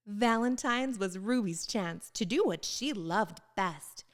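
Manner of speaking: ordinary delivery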